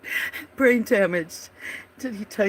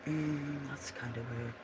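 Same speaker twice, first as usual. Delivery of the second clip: normal talking voice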